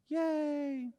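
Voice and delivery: high, bright little whoop of joy; mock cheer. Advisor cheering the widower's tax-free payout to clients, in a mock gleeful whoop. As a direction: squeal it like a kid at a party.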